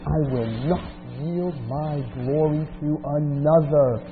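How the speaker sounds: preacher thundering God's words; forceful preaching. Acted. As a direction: declare it with the weight of God's voice. Reads Isaiah 48 forcefully.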